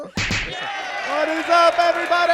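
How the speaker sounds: loud, high, slow hype yell; hype shout. Acted. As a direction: blast the opener like a host. Hyped show opening.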